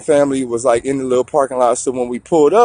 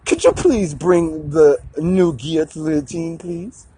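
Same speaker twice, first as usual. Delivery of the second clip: polite king; 2 semitones higher, 3 dB quieter, slower than usual. Acted. King politely asks for guillotine.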